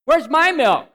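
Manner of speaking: put-on high, loud little kid demanding milk; a small child loudly demanding his milk; demanding toddler. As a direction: squeal it like a bossy toddler. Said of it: "he says".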